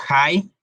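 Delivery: "hi" with an audible h, rhyming with "pie"; H like Spanish J. Shown as a demonstration. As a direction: start "hi" on a breath like a Spanish j. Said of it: "la H ... se pronuncia como una J".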